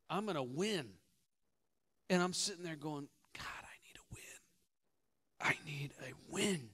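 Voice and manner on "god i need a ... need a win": urgent breathy whisper to God; whispered prayer. Retold or imitated. Preacher on court begging God for a win, whispered. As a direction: pray under your breath before the point.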